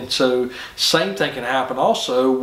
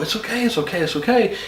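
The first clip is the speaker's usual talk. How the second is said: higher, cooing mock reassurance; coddling; 7 semitones higher than usual. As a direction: coo it like calming a scared pup. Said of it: "they tend to coddle".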